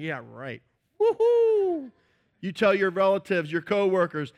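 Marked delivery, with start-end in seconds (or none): 0.0-0.6 sceptical. Retold or imitated. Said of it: "And people are like". 1.0-1.9 mocking high woohoo. Mocking cheer.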